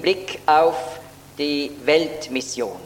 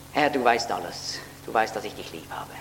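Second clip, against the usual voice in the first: subdued, lower-pitched retold reply; saddened reply; 4 semitones lower, 4 dB quieter than usual. Preacher voices Peter answering the Lord's third question, mildly saddened. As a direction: quote Peter with a touch of sadness. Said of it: "wird traurig".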